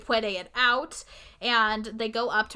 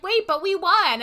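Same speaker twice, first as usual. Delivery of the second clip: higher, louder cry of protest; indignant protest; 8 semitones higher, 5 dB louder than usual. Retold or imitated. Alyssa protests to her angry parents, higher indignant cry. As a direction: protest it like a kid who can't believe it.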